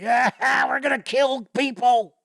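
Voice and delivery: high-pitched, hammed-up goblin war cry; mock bloodthirsty goblins. Goblins supposedly out to kill people, mock menacing. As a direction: play a cartoonish goblin threat up high.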